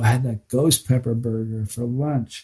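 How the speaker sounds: low, soft sheepish admission; sheepish confession. Retold or imitated. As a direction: quietly own up to it.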